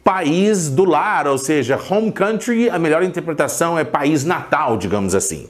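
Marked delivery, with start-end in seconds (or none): none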